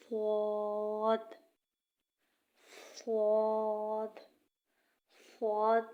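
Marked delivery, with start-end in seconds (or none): 0.1-4.2 صاد said thick, slow and louder; heavy saad. Shown as a demonstration. Teacher showing a heavy letter, slow and loud. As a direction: make صاد full and heavy. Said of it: "موٹا کر کے پڑھا جائے گا".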